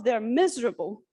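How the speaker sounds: usual speaking voice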